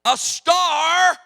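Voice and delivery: loud, high outburst; indignant shout. Real, not acted. Preacher boasting he was a sixteen-year-old star, shouted. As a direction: bellow it in mock pride. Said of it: "I am sixteen years of age".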